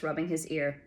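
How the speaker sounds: plain reading voice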